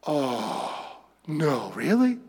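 quietly amazed, hardly believing it; stunned disbelief. Acted. Peter stunned at what God means, soft and amazed. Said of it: "and all of a sudden".

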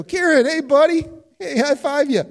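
high, loud, overly chummy hello; hammed-up buddy greeting. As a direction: play the jolly pal to soften the ask. Pastor greets Kieran, chasing the debt.

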